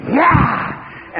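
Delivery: sudden high yelp to scare; father springing out at his son, a playful yell; mock scare shout. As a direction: jump out at the boy. Father scaring son as Wolfman.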